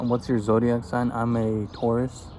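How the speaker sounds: ordinary delivery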